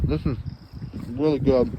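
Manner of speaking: talking through a mouthful; words round a bite of food; mouth full. Real, not acted. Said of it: "Sorry to talk with my mouth full".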